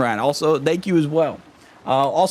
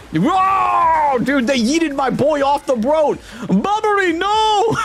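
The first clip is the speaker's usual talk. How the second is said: shocked yelp; 12 semitones higher, slower than usual. Real, not acted. Streamer watching his boy thrown off, shocked yelp. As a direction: cry out as he flies off.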